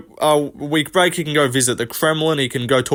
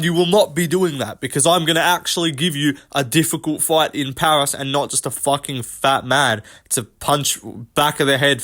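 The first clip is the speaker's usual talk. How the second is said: ordinary talk, nothing marked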